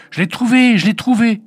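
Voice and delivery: high, loud exultant shout; joyful cry. A narrator's reading. Little girl finds the egg, joyful cry. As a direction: cry out wild with joy at the find. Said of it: "elle se mit à crier".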